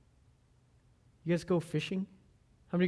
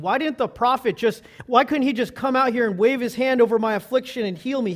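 higher, louder, affronted protest; indignant complaint; 6 semitones higher, 8 dB louder than usual. Acted. Naaman complaining about the prophet, loudly affronted. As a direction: demand it like an offended proud man. Acting Naaman's offended expectation.

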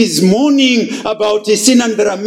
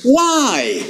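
mock incredulous questioning; 6 semitones higher, slower than usual. Preacher mock-questioning the weeping psalmist, higher and insistent. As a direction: challenge the psalmist in disbelief. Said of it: "questioning the psalmist".

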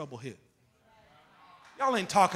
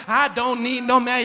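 sassy; 3 semitones higher, 11 dB louder, slower than usual. Retold or imitated. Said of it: "your neck starts moving a certain way".

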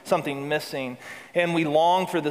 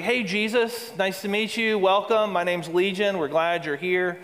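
high, breezy, chirpy hello; mock the casual reading as a friendly welcome; banal cheery greeting; 4 semitones higher than usual. Preacher mocking a banal reading of Legion greeting Jesus, breezy and high. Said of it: "read this verse in a real sort of banal way".

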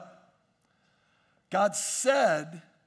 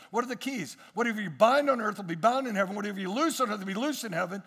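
ordinary delivery

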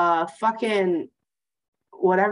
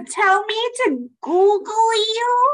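high, drawn-out outraged question; scandalized, squealing disbelief; incredulous; 12 semitones higher, slower than usual. Real, not acted. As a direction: stretch it upward in sheer disbelief. Outraged at being told to Google him.

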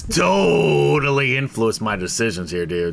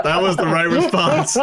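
laughing; 5 semitones higher than usual. Real, not acted. Laughing at player reply.

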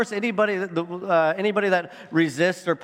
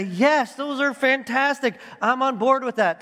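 higher-pitched, approving listener; agreeing congregation; 7 semitones higher than usual. Acted. Preacher voicing listeners who approve the five solas, higher-pitched agreement. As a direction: voice an eager yes from the pews. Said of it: "To which you would say".

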